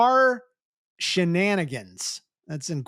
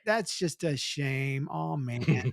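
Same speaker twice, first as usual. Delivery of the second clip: mock disappointment; 6 semitones lower, 7 dB quieter, slower than usual. Real, not acted.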